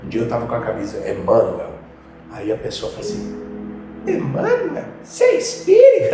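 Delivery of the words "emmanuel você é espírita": much higher, squeaky mock voice of a stranger; high mocking voice asking about the shirt; mock curious stranger. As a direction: ham up a puzzled stranger, laughing. Stranger asks about his shirt.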